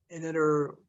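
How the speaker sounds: ordinary delivery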